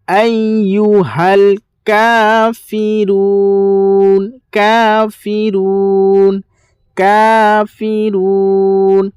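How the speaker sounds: কাফিরুন stretched long and chanted; drawn-out recitation. Shown as a demonstration. Teacher demonstrates কাফিরুন for learners, long and chanted. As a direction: sing out কাফিরুন slowly, holding each vowel. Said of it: "আলিফ ইয়া জের আই".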